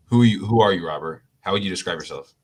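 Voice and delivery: usual speaking voice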